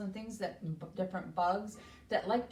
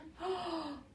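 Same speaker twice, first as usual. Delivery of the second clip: excited gasp; 9 semitones higher, 5 dB quieter than usual. Acted. Dewey sees his tree.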